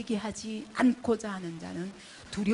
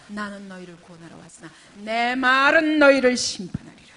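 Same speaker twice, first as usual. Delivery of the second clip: full-force preaching; 15 dB louder than usual. Acted. Pastor pressing the congregation, preaching at full force. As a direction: preach it out to the whole hall. Pastor quoting Jesus' words.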